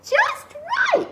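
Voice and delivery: tiny, very high-pitched Goldilocks voice. A narrator's reading. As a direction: delicate little high squeak. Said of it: "And she says".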